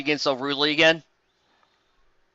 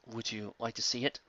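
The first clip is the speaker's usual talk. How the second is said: quiet taunt; 5 semitones lower, 15 dB quieter than usual. Acted. Taunting villain voice.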